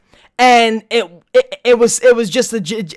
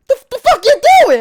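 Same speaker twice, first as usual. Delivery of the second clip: high, loud what-are-you-doing yell; mock outraged yell; 5 dB louder than usual. Telling Jack about the dream, a high mock outraged yell.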